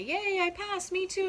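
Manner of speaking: high squeaky cheer; cheering graduate. Acted. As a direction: celebrate passing, high and bright.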